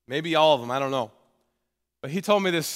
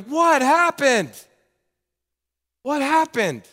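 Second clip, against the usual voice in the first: drawn-out, pained, high and louder; anguished cry; 8 semitones higher, 5 dB louder, slower than usual. Retold or imitated. Pastor lamenting friends who drifted, with high pained cry. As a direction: grieve over them aloud.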